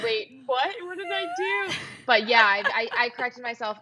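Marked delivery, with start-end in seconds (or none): 0.0-1.7 surprised. Retold or imitated. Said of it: "I was like".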